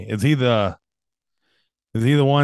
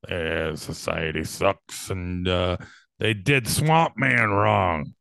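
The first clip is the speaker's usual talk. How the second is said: low, slow, soft grumble; grumbling impression; 7 semitones lower, 4 dB quieter, slower than usual. Imitating Alan Moore complaining in news pieces, grumbling. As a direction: grouse under your breath like an old crank. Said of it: "like, grumble about something".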